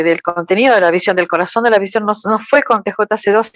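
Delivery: ordinary talk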